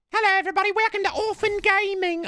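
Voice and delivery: very high British orphan streamer; little British orphan. Acted. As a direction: greet viewers as a tiny British waif. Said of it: "it's a little British orphan playing".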